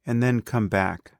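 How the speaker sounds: calm, unhurried meditation guide with long pauses; guided meditation. Acted. Teacher leading a beginner through breath awareness, calm guided meditation.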